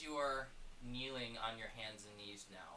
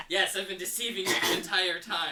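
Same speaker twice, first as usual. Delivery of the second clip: laughing; 8 semitones higher, 19 dB louder than usual. Real, not acted. GM jokes while laughing.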